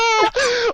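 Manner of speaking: high, loud laugh; real burst of laughter. Real, not acted. Laughing at the joke.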